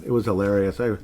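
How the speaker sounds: normal talking voice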